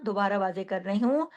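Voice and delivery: normal talking voice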